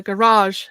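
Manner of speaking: "garage" as guh-RAHZH; American pronunciation. Shown as a demonstration. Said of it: "for the Americans".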